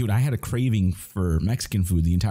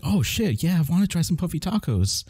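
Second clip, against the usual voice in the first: mock excitement; 9 semitones higher than usual. Excited about puffy tacos.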